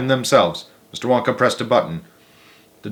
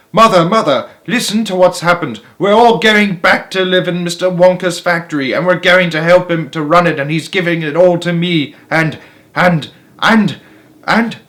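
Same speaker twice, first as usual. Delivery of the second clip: put-on high, loud tumbling child's words; breathless excitement; 6 semitones higher, 4 dB louder than usual. A narrator's reading. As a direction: rush it out, overexcited. Charlie babbles excitedly.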